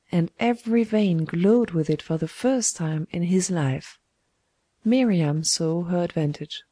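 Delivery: plain reading voice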